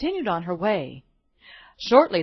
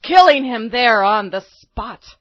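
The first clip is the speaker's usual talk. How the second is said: excited narration; 8 semitones higher, 10 dB louder than usual. A narrator's reading.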